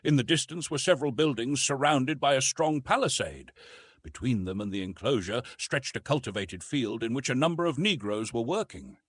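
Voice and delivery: plain reading voice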